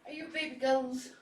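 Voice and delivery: put-on high, sing-song baby talk to the cats; cooing pet voice. Owner greeting her cats in a high cooing pet voice. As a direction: coo at them like they're babies.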